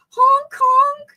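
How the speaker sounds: high, loud imitated honk; clown honk. Shown as a demonstration. Streamer trying out a clown honk for chat, imitated honking. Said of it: "I can't do it good".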